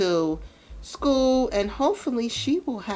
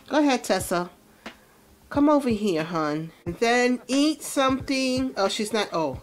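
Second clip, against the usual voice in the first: ordinary talk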